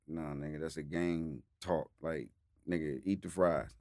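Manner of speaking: a hint of a low street guy; low gruff man. Retold low gruff vendor.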